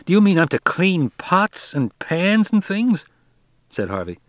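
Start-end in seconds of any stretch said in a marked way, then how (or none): 0.1-3.1 put-on higher, slow, incredulous boy's voice; a spoiled boy who can't believe he must scrub pots; indignant rich boy. A narrator's reading. Spoiled boy to the skipper, higher and incredulous.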